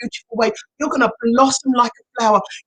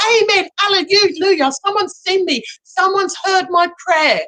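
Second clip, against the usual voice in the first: high, drawn-out praise; acted grateful rejoicing; 7 semitones higher than usual. Coach voices someone thankful for the gift, acted rejoicing. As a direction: cry it out like an answered prayer.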